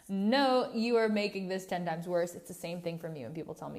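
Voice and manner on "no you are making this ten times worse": mock exasperated retort. Retorting to being told calm down.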